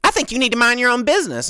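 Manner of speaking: high, loud, fast huffy mock voice; hammed-up snooty retort; imitating the snippy customer. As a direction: rattle it off like an offended entitled customer. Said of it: "And she's like".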